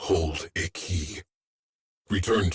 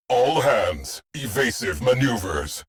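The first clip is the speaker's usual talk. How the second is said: raised, higher-pitched battle alert; ship-wide emergency order; urgent command; 6 semitones higher than usual. Acted. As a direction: call all hands to evade. Ship's alert during sudden attack.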